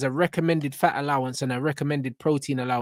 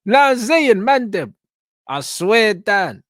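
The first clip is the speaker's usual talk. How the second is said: much higher and louder, calling out to his mates; excited call-out; 7 semitones higher, 8 dB louder, much slower than usual. Real, not acted. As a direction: hype them up, shouting their names. Hyping up friends loudly.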